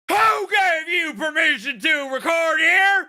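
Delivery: high, loud drill sergeant bark; drill sergeant Karen. Retold or imitated. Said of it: "The way she says it".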